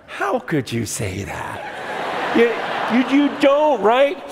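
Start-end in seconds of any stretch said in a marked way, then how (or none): 0.1-2.4 mock solemn question. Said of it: "No one talks like that".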